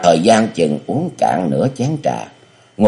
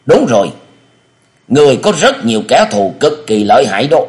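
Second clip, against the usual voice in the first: put-on much higher, louder cunning old master; scheming elder; 7 semitones higher, 4 dB louder than usual. Địa Linh feeds the amnesiac hero a false name, high and sly. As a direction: coax the name into him, high and insistent.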